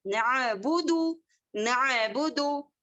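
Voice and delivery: नाबुदु with a stretched a instead of the ain; wrong way. Shown as a demonstration. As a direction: demonstrate the error slowly. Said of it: "नाबुदु नहीं करेंगे".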